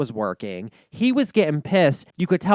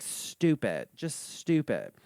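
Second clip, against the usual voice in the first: muttered scorn; 9 dB quieter, slower than usual. Retold or imitated. Reacting to Billy on the show, softly scornful. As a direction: mutter it under your breath. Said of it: "I'm like".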